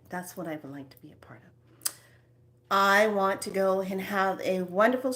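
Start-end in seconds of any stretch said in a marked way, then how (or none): 0.1-1.9 quick excited whisper. Pretend. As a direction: whisper the wish, hurried. Imagining a shopping wish.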